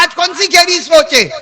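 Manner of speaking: high, loud scolding shout; angry king's shout. Acted. King Harun Rashid scolding Bahlul in the graveyard, loud angry shout. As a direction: yell at him for staying seated.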